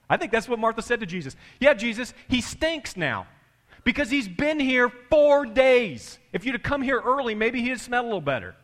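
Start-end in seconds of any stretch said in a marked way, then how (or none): 1.6-8.5 high, loud, indignant complaint; hammed-up grieving sister's scolding; exasperated reproach. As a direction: throw the blame at him, pitched high. Acting Martha rebuking Jesus.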